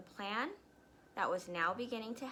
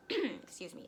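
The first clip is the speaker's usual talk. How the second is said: ordinary delivery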